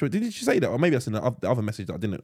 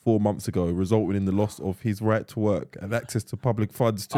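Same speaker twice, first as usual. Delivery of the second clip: low, measured read-aloud voice; steady, low-pitched reading; reading a message aloud; 12 semitones lower than usual. Acted. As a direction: read out the written letter evenly. Reads a written message aloud.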